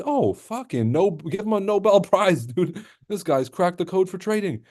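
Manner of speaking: sarcastic mock wonder at an obvious tip; sarcastic awe. Real, not acted. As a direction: gush with fake admiration.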